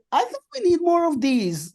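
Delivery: retold burst of keen enthusiasm; excited reaction. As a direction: exclaim it high, as if reliving the moment. Retelling own excited reaction.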